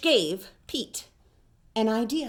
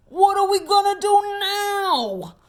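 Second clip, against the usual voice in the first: much higher whiny moan; 9 semitones higher, 4 dB louder than usual. Acted. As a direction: whine it out in despair. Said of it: "moaned Big Bob".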